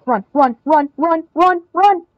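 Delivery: staccato chant climbing in pitch; rising, urgent repetition; building urgency. Real, not acted. Urging run, building during play.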